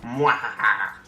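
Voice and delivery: hammy villain cackle; gloating villain laugh; evil laugh. Pretend. Gloating again.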